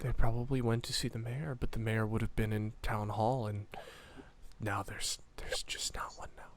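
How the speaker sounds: very soft and low, fading to a whisper; frightened kid. Acted. Scared kid telling where they went, hushed, fading. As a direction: let it sink away into a whisper.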